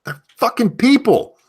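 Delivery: loud, high-pitched exclamation; exasperated outburst. Real, not acted. Host telling guys women are just people, exasperated outburst.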